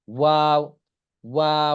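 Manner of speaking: ওয়াও held long, lips rounded and never closing. Shown as a demonstration. Teacher models the letter for learners, ওয়াও with rounded open lips. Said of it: "মুখ গোল হয়ে তারপরে উচ্চারণ হবে".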